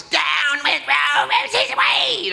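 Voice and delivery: high, drawn-out mock demon shout. Preacher mocking a woman on air, demon shout. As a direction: mimic her like a possessed woman. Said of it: "with her demon voice".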